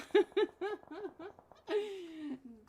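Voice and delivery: high-pitched run of laughter; giggling fit. Real, not acted. Caster watching the match, high giggling fit. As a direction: burst into helpless giggles.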